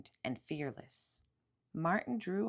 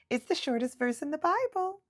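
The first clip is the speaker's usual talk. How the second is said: put-on higher, grinning little boy; with a grin; 9 semitones higher, 4 dB louder than usual. A narrator's reading. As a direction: say it with a cheeky smile. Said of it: "said Martin with a grin".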